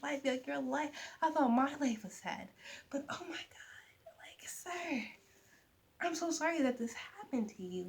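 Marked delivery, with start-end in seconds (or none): 2.9-5.0 breathless whisper, high and soft; awed whisper. Real, not acted. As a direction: whisper it as if stunned by sadness. Overwhelmed by a sad book.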